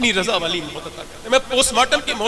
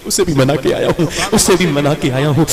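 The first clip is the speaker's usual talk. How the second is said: building preaching; 3 semitones lower, 11 dB louder than usual. Real, not acted. Repeated line, rising force.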